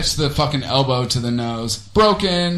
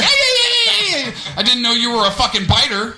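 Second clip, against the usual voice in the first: shrill comic freakout; 8 semitones higher than usual. Retold or imitated.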